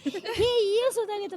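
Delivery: high-pitched imitated protest; mock reproach. Acts out a reproach to her.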